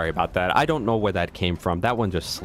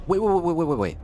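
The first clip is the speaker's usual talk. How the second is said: excited; 8 semitones higher, 3 dB louder, faster than usual. Real, not acted. Sudden realization, excited.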